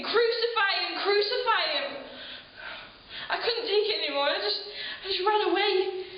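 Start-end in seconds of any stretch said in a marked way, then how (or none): 0.0-2.0 mock crowd scream. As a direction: call it out like a baying crowd, held back. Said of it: "everybody just started screaming".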